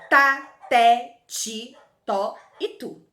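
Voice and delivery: tá and té called out loud; loud syllable drill. Shown as a demonstration. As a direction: call out the T syllables clearly. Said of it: "sílabas iniciadas por T".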